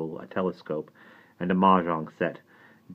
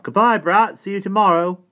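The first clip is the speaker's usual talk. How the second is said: high, loud call over the shoulder; cheery call from a distance; 11 dB louder than usual. A narrator's reading. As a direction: shout a goodbye while walking off. Simon calls over his shoulder.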